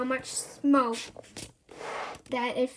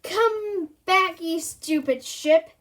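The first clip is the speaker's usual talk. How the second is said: loud desperate call, slower on the insult; yelling after ship; 4 semitones higher, 5 dB louder, slower than usual. Acted. Castaway yelling at the ship that ignored them, loud. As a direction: shout it at a ship sailing away.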